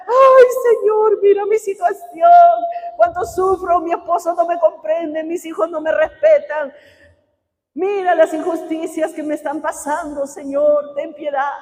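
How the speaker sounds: mock sobbing complaint to the Lord; mock whining prayer. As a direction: play the self-pitying believer begging God. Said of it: "venimos a Dios solamente para llorar".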